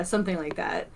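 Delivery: usual speaking voice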